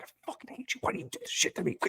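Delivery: whispered then very high strangled whine; hammed-up betrayed friend; mock anguished wail.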